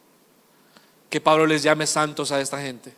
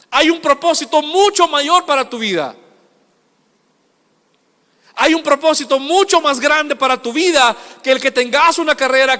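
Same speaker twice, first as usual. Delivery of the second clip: sustained high, forceful pulpit delivery; preaching at full force; 8 semitones higher, 7 dB louder than usual. Real, not acted. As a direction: build through the list with mounting volume.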